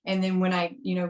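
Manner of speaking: usual speaking voice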